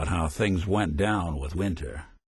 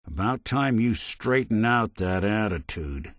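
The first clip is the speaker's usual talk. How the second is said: ordinary talk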